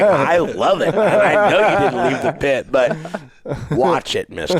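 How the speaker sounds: laughing tease. Retold or imitated. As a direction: laugh while warning dad playfully. Teasing dad, laughing.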